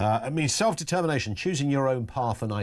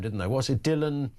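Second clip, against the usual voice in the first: ordinary talk, nothing marked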